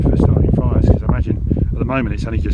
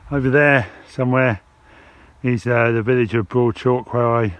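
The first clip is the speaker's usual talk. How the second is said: ordinary talk, nothing marked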